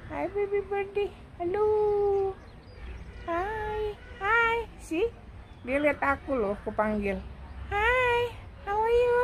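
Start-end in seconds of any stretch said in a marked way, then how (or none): 0.1-5.3 high, sing-song cooing. Real, not acted. Calling to a bird. 7.7-9.2 high cooing greeting. Real, not acted. Greeting a bird.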